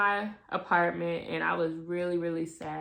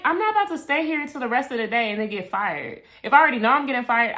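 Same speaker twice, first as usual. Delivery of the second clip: rushed retold reasoning; 2 semitones higher, faster than usual.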